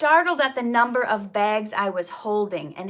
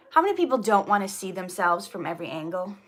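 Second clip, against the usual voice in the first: ordinary delivery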